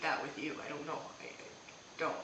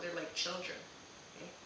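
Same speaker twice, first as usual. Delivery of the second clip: usual speaking voice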